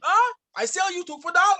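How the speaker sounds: put-on high, haggling Middle Eastern-accented seller. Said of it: "it was a Middle Eastern origin accent".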